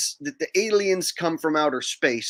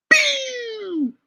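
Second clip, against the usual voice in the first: high squeaky pew zap; mock laser sound; 4 dB louder than usual. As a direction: spit out a cartoon laser blast. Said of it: "play a sound effect".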